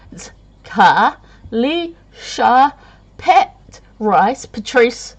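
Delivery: Ka Li Sha Pat Rice, each syllable split slowly; spelled out slowly. Shown as a demonstration. Showing viewers her first name is easy to say, slowly. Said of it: "my name's not too hard".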